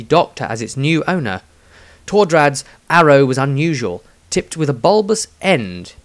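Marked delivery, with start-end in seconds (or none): none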